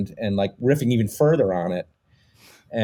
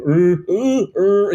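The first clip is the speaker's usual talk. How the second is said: put-on raised "er" sounds hunting for an accent; imitated mouth warm-up noises; 5 semitones higher, much slower than usual. Showing how he searched for that accent, imitated mouth noises. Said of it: "certain mouth things that he would try".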